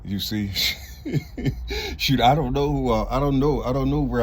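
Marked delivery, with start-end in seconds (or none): 0.6-1.9 high airy laugh with no voice; breathy unvoiced laugh. Real, not acted. Laughs after talking about food money.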